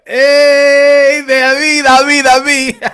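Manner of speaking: loud laughing call. Real, not acted. Calling a friend over, loud and laughing. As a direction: shout his name through a laugh.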